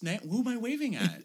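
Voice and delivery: high, puzzled mock voice; lightly voiced bewildered starlet; mock confused actress. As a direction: play her lost and confused. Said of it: "And she's like".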